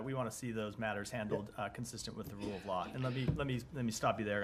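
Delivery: hoarse, subdued, fading voice; hoarse from coughing. Real, not acted. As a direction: hold back a cough while speaking. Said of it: "continues coughing".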